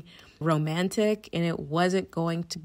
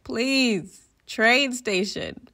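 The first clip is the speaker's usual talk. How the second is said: higher, louder, slow imploring plea; pleading; 5 semitones higher, 5 dB louder, much slower than usual. Retold or imitated. Begging airport staff for the train station, slow and pleading. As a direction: beg slowly for directions. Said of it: "I was just pleading with them".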